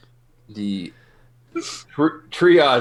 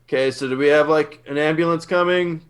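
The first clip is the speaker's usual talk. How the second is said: raised, louder, questioning call; checking on help; 5 semitones higher, 4 dB louder than usual. Acted. Player's character asks if an ambulance is coming, checking in louder. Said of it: "be like".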